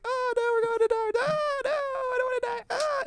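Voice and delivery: high, squealing mock terror; mock panic. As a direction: squeal it like you're about to die. Panicked quote in retold scene.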